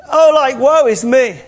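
hammed-up wail of woe; crying out. God lamenting in Genesis, loud high mock wail. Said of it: "crying out".

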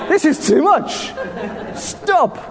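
high, laughing plea to stop; mock complaining body. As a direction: play your tired body begging. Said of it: "My body's saying".

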